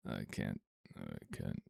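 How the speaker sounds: deep cracked croak, unused voice; voice gone. Real, not acted. Streamer asked to do voice, croaking he can't. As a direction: croak it out before warming up. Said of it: "I haven't been talking".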